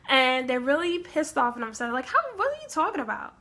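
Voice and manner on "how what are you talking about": high, fast disbelieving question; incredulous. Retold or imitated. Vikings confronting the orcs, high and incredulous. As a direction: squeak out the disbelief. Vikings baffled by orc claim.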